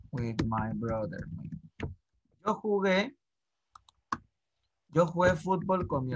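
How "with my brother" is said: a lower, softer, slow echo of "With my brother"; soft repeat. Shown as a demonstration. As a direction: drop your voice and repeat it gently for the class. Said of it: "teacher repeats phrase, softer". (repetición suave y lenta)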